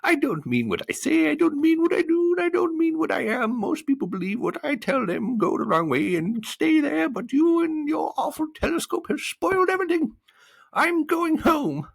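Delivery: very high, loud hammed-up wail; hysterical crying. A narrator's reading. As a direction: sob hysterically as a little creature. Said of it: "And crying hysterically".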